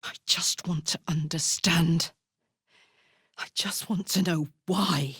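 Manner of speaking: hushed pleading whisper. Acted. Begging for understanding, a whispered plea.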